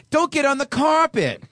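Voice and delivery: high, loud nag; nagging wife. Retold or imitated. Wife scolding her husband, high and loud. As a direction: snap it like a fussy wife.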